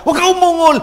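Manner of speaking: loud, high scolding voice; mock scolding. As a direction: scold like a strict parent. Scolding someone for groaning. (mapagsaway na sigaw)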